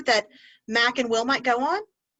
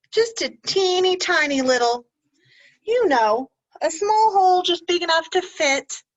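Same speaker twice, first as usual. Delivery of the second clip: tiny voice; 7 semitones higher, 6 dB louder than usual. Acted. Showing a tiny hole.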